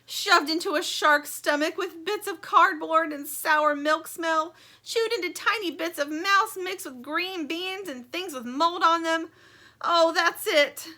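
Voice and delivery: high, loud, slow gruesome lament; dramatic Stingray. Acted. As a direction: paint the horror melodramatically. Stingray imagining the mice.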